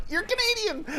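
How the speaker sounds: very high mock-excited squeal. Fan spotting a real Canadian, squealed in excitement. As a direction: blurt it out high, breaking into laughter.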